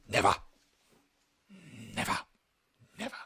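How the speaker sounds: abrupt imitation of a forgotten line; Michael Caine. Podcaster imitating the actor who forgot his line, abrupt reading. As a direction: say each "never" as if prodded. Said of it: "someone had to poke him with a stick".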